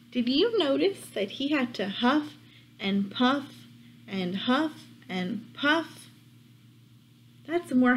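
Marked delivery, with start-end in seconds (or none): none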